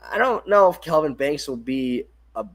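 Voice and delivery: ordinary talk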